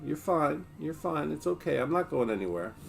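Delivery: soft, reassuring murmur to the dog; calm her down gently; soothing. Real, not acted.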